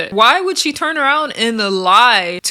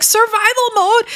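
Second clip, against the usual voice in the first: much higher, disbelieving question; incredulous; 13 semitones higher, 3 dB louder than usual. Retold or imitated. [name] mocking the survival claim, incredulous. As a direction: repeat it in disbelief. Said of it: "[name] was like".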